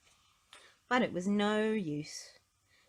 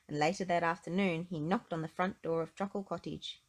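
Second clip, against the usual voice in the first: plain reading voice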